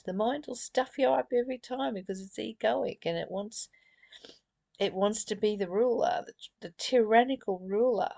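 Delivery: ordinary talk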